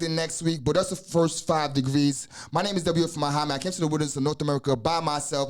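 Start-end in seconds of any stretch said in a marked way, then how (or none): 2.5-3.6 fast, even run-through of a learned text; rapid recitation. Real, not acted. Teacher running through the five degrees, rapid recitation. 3.6-5.5 fast, even run-through of a learned text; rapid recitation. Real, not acted. Teacher running through the five degrees, rapid recitation.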